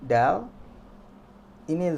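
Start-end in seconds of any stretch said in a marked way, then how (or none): 0.0-0.5 "Dal" with a plain D; emphatic plain-D "Dal"; ordinary D. Shown as a demonstration. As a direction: say "Dal" louder, clean d. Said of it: "seperti huruf D biasa".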